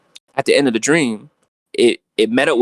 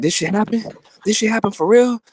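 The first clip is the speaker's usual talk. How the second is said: stunned disbelief; 10 semitones higher than usual. Retold or imitated. Stunned waking from the dream.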